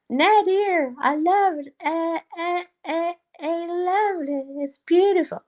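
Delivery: high-pitched gushing mock southern accent. Streamer as Dee Dee fawning over Nader's food, high southern accent. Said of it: "she has a southern accent".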